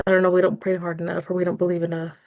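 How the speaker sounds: usual speaking voice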